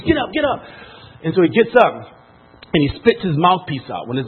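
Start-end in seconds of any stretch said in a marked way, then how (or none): 0.0-0.6 high-pitched sideline urging; retold high, strained cheer; urgent encouragement. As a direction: call a fallen fighter to his feet. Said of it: "encourage him from the side of the cage".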